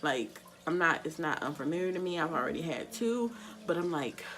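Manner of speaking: ordinary talk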